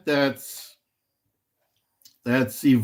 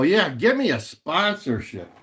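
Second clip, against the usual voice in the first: ordinary talk, nothing marked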